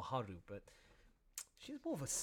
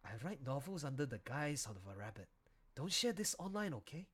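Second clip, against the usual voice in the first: usual speaking voice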